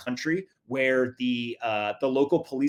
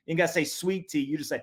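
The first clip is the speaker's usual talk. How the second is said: ordinary talk, nothing marked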